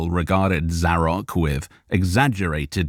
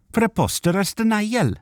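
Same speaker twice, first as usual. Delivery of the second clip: a high, spluttering Welsh outburst; sputtering Welsh elf. A narrator's reading. Arundel the elf rejects the goblin's denial, spluttering. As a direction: splutter with indignation in a Welsh lilt. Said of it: "Arundel sputtered".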